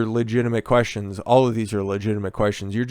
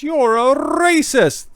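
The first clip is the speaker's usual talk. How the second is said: mock accuser; 6 dB louder, much slower than usual. Mocking critics' stock accusation.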